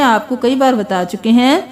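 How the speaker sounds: ordinary talk, nothing marked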